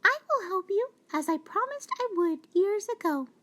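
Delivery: high, quick mouse question; mouse asking. Acted. Mouse arriving to answer the lion's call, high and quick. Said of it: "she asked".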